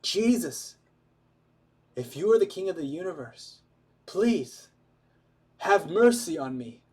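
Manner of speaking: high, loud plea to a king; loud, imploring prayer with spaced-out phrases; pleading cry. Shown as a demonstration. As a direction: cry out for mercy, slowly and forcefully. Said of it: "and you can say".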